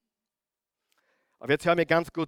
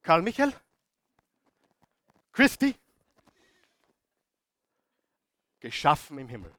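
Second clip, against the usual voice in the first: mock voice of God. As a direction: ham up God matchmaking a couple. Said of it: "Gott ... sagt". (Gott verkündet feierlich und überzogen ein Paar im Himmel)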